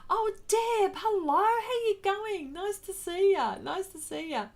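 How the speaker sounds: high, bright sing-song hello; excited greeting. Real, not acted. Greeting Deb as she joins the stream, high and excited. Greeting viewer in livestream chat.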